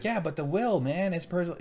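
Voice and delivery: high-pitched mock protest; play-acted objection. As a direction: mimic a character pleading his point. Voices a character objecting.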